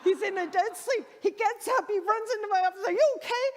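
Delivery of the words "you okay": loud, high-pitched check in a panic; alarmed husband. Retold or imitated. Husband running into the office from dead sleep, alarmed. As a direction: burst in, jolted out of sleep.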